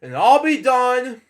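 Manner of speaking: plain reading voice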